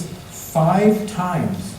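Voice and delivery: usual speaking voice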